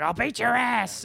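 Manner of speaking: high, loud angry yell; snapping. Retold or imitated. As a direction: act out a man exploding in rage. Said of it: "He just snaps".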